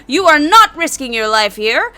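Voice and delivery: fight announcer. Acted. Referee warning potential challengers of the beating, loud announcement. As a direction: lay down the rules to a rowdy crowd.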